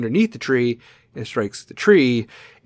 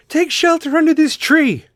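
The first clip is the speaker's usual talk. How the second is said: panicky voice; 13 semitones higher, 5 dB louder than usual. Retold or imitated. Mocking panicked parkgoers.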